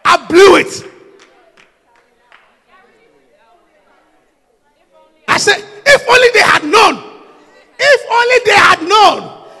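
shouted, high sermon climax; preaching at full force. Real, not acted. Preacher shouting to the congregation, full force.

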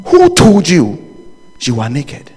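ordinary delivery

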